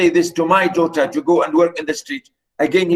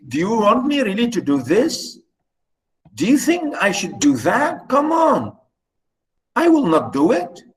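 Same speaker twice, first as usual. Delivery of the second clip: higher-pitched mild shocked protest; shocked, amazed; 6 semitones higher than usual. Acted. Daughter asked by her stepmother to work the streets, shocked. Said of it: "the daughter is shocked, amazed".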